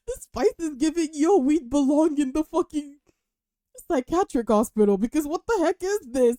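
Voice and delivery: normal talking voice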